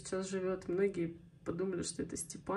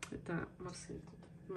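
whispering; 11 dB quieter, slower than usual. Real, not acted. Aside about someone nearby.

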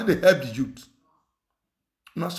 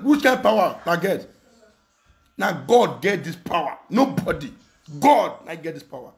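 ordinary talk, nothing marked

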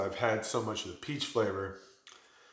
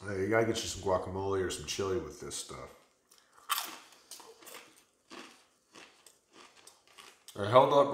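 chip in hand between bites; speaking with food in mouth; talking while chewing; 2 semitones lower than usual. Real, not acted. Seen 1: Holds corn chip while talking; ate chips just before, lips working.

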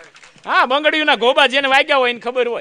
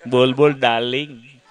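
sweet talk; 9 semitones lower than usual. Acted. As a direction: melt for the darling on the phone. Sweet answer to girlfriend.

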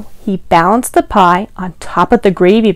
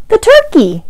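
excited answer; 13 semitones higher than usual. Shown as a demonstration. Reader confirms children's answer.

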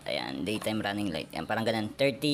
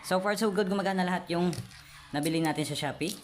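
excited; 7 semitones higher than usual. Real, not acted. Excited product test success.

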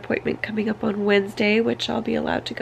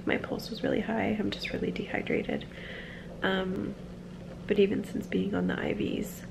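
soft, half-whispered update; hushed voice; 9 dB quieter than usual. Real, not acted. Vlogging from a hospital bed to her viewers, hushed.

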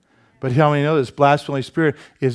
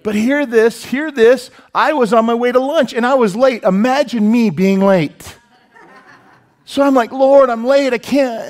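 excited preaching; 9 semitones higher, 7 dB louder than usual. Real, not acted. Excited story climax, preaching higher.

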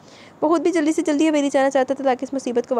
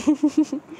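short, higher-pitched giggle; 5 semitones higher than usual. Real, not acted. Narrator amused by the two men's entertainment, giggling. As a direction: giggle at your own story.

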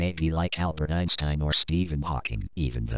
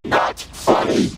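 annoyed; 9 dB louder, slower than usual. Acted. Annoyed at mockery.

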